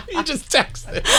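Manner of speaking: high-pitched laughter through the words; can barely get the words out for laughing. Real, not acted. Host cracking up retelling the boss's text, laughing. Cracking up at his own story.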